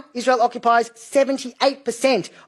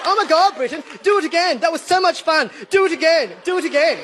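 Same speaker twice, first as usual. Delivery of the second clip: hammed-up higher, gushing cheer; mock excited natives; 6 semitones higher than usual. Natives mockingly begging Britain to do it again, hammed-up and excited. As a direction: gush like they loved every second. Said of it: "The natives are like".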